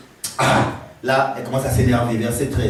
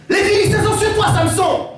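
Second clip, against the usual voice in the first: cri d'alerte aigu. Acted. Dalila crie l'alerte.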